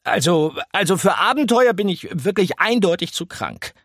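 a fussy mouse making excuses; apologetic mouse. A narrator's reading. Mouse apologizes to disappointed rats. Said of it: "fügte er entschuldigend hinzu".

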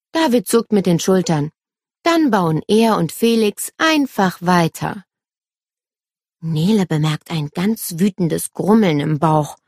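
plain reading voice

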